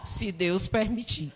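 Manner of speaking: imitating her father. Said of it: "Eu dizia".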